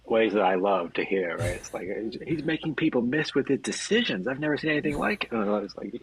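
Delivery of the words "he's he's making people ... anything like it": high-pitched imitation of a gushing announcer. Fan imitating an announcer raving about a star player, gushing. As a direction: gush in mock amazement, pitched well up. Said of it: "gush about Le'Veon Bell".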